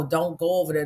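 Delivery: ordinary talk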